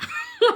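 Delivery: short high burst of laughter; a quick amused laugh. Real, not acted. Laughing at Moses refusing.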